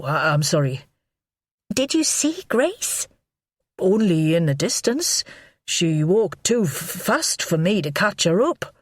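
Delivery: stammering, rambling nervous man; panicky man. A narrator's reading. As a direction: babble out the worry. Godfrey's nervous voice.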